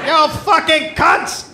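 hammed-up crude hello; stretched, high, goofy cheer; mock sailor greeting. Acting the Frenchman.

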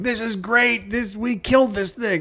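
hammed-up cheerful carnivore; mock smug diner. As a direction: play a self-satisfied diner at the feast. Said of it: "sitting down and going".

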